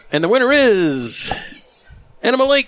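big excited winner reveal; suspenseful awards presenter. Acted. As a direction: announce the winner with a cheer. Announces award winner, shouting.

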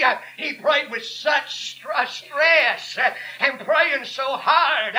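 full-force sermon with gasped breaks between phrases; fervent preaching. Real, not acted. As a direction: drive each phrase home to the congregation.

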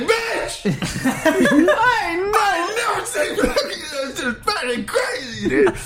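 high, hammed-up shouting comic, laughing through it. Comic on the Apollo stage, high hammed-up yelling. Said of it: "just be like".

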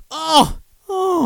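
mock-wounded. Mock-wounded by a friend's message.

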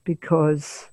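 normal talking voice

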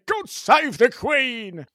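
a slow patriotic cheer without real volume; mock shout. British people hailing the Queen, slow mock shouting. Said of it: "all the British people are shouting".

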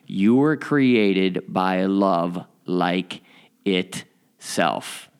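measured, weighty reading, word by word; slow and deliberate. Real, not acted. Podcaster reading the lesson's key truth to listeners, slow and deliberate. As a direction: draw each word out, pausing before the last.

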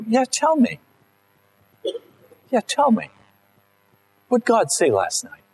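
high, keen and impatient; eager Saul. Acted. Preacher voicing Saul pressing Samuel, high and eager. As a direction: Saul pestering Samuel for the news.

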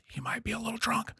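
hushed, breathy warning whisper; whispered aside. Retold or imitated. Telling the podcast hosts the coworker might be drunk, whispered. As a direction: drop to a whisper as if sharing a secret.

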